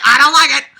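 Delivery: hammed-up possessive yell; mock jealous yell. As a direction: shout it like a jealous child.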